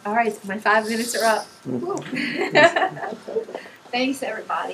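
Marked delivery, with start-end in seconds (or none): 1.5-3.8 loud, high burst of laughter; real, relieved-sounding laughter; hearty laugh. Real, not acted. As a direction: laugh out loud to break the emotion. Laughs as time is up.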